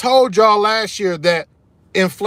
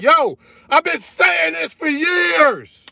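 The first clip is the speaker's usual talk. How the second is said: fired-up shout, much higher, slow and stretched; real yell; 10 semitones higher, much slower than usual. Real, not acted. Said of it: "I'm sorry for yelling".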